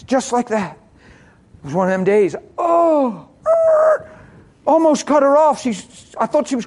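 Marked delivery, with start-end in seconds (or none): 2.6-4.1 high, loud "oh" into a screech; startled yelp, then screeching noise; acted alarm. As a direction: imitate a sudden swerve and brake squeal. Acts out nearly hitting a walker.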